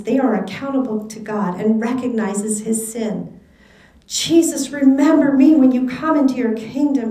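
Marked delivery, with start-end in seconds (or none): 4.1-7.1 raised, pleading cry from the cross; cried out. A narrator's reading. Dying criminal calls out to Jesus, a raised plea. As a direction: call out to Jesus, louder and higher. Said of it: "he cried".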